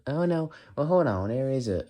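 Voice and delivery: Southern woman attempt; female-sounding voice. Shown as a demonstration. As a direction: lift it into a feminine lilt. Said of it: "that sounds more female".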